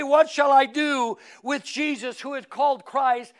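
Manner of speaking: loud, high, ringing pulpit cry; preaching at full force. Acted. Preacher challenging the congregation with loud, high questions. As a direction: drive each question home to the pews.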